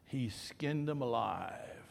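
slow whispered confidence; retold whisper. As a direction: lean in and whisper it slowly, like a secret. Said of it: "the funeral home guy said".

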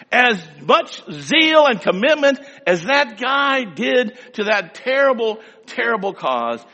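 loud, rising pulpit call; rally the men with zeal; fervent preaching. Acted. Preacher challenging the men, loud and passionate.

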